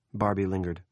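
usual speaking voice

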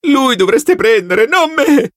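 panicked yell. Acted. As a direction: shout to shift the blame, terrified. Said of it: "urlò".

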